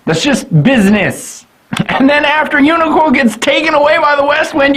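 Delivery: ordinary talk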